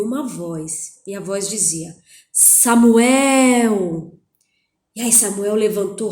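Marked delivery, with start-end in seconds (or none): 2.3-4.1 put-on a loud, drawn-out call of the boy's name; the voice of God calling in the night. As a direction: call Samuel from far away, loud and long. God's voice calling the boy.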